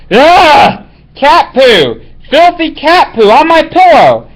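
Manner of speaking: high, loud disgusted shriek; screaming in disgust. Acted. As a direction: scream it out, horrified at the pillow. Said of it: "She pulls back the blanket and screams".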